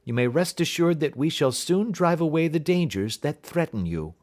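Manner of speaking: confident detective reassuring a frightened client; detective's voice. Acted.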